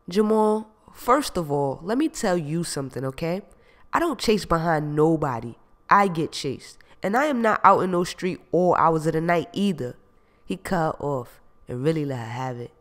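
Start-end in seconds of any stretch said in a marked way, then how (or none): 0.1-9.9 indignant retort. Acted. Gina firing back at Jamal, indignant and loud. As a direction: set him straight, hard.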